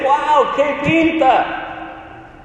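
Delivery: high, slow admiring gush; mock amazement. Preacher mimicking what Isaiah does not say, mock awestruck. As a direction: stretch out an overdone wowed reaction.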